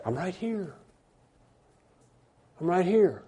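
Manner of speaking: soft, understated answer; reply softly, as God calmly present; quiet reassurance. Acted. God's calm answer to the plea.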